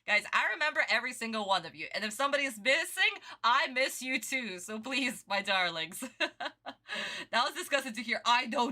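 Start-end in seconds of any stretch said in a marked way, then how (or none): none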